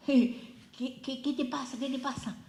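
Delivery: startled imitation. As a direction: imitate someone startled and worried, stammering the question. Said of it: "Rey se asustó".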